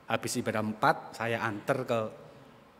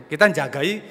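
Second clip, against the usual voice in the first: ordinary delivery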